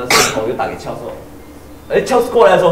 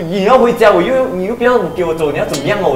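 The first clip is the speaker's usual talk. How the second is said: higher, louder retold argument; exasperated retort; 5 semitones higher, 5 dB louder than usual. She argues with her drunk friend, exasperated and loud. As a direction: fire it back at him, fed up.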